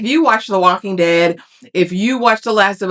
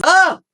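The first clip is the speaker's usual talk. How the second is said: frustrated ugh; 12 semitones higher than usual. Real, not acted. Frustrated by the show's writing.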